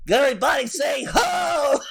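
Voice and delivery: high hype-man shout. Pretend. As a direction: call out to the crowd. Hype call to crowd.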